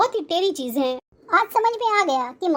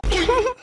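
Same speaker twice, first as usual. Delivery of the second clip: giggle; 4 semitones higher than usual. Acted. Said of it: "तुम हंस क्यों रही हो?".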